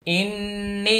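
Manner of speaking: nasal hum held on the doubled n of "ইন্নি"; ghunnah, then long stretch. Shown as a demonstration. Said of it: "তারপরে তিন আলিফ টান". ("ইন্নি" গুন্না করে, শেষে টেনে)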